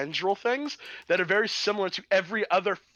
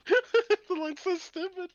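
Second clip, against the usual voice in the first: laughing disbelief; much slower than usual. Real, not acted. Laughing at the film line.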